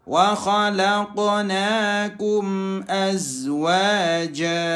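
chanted tajwid with bounced q; Quran recitation. Shown as a demonstration. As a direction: recite slowly, every q audible. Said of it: "qafnya harus kedengeran". (bacaan tajwid dengan qalqalah jelas)

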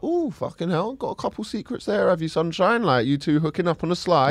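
high, softened cheeky aside; teasing imagined quote. Retold or imitated. Man imagines a cheeky queue remark, high teasing voice. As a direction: tease in a lifted, lighter voice.

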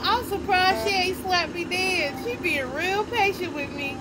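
ordinary delivery

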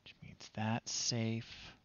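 slow, breathy whisper to himself. Real, not acted. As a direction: think aloud in a slow whisper. Muttering to himself while playing.

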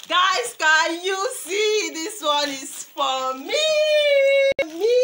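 high, loud excited cry; overjoyed squeal. Real, not acted. Mother unboxing gifts for her children, squealing with joy. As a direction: squeal with delight at the gift.

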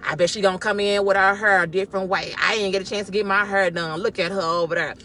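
low catty aside; gossiping churchgoer. Acted. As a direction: imitate someone judging under their breath. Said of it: "Don't come in and be like".

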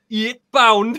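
mock Geordie. Buying drinks in Newcastle, a laughing Geordie imitation. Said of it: "I went".

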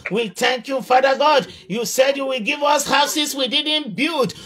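ordinary talk, nothing marked

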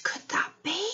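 hushed storyteller whisper. Acted. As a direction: whisper it with suspense. Hushed suspense for children.

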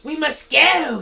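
high, loud urgent cry; hissing impatience. Acted. Gollum hurrying Frodo, high and frantic. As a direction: urge him on in a panic. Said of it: "hissing with fear and impatience".

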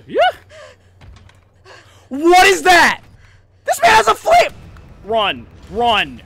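panicked high shouting; being chased. Real, not acted. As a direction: scream it while fleeing in panic. Fleeing a flamethrower enemy.

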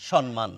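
সম্মান said as "shon-man", n before m; n pronunciation. Shown as a demonstration. Teacher showing the form to avoid, সম্মান with an n. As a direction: put an n in সম্মান. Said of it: "ন কোনোভাবেই আসার কথা নয়".

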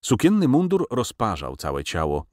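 ordinary delivery, nothing marked

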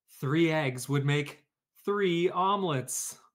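ordinary delivery

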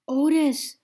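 high, loud holler. A narrator's reading. The narrator hollers to Otis in the rain, loud. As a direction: call over the rain. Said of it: "I hollered at him over the rain".

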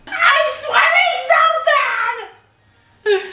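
high, loud squeal; excited squeal. Real, not acted. Tipsy end of tasting.

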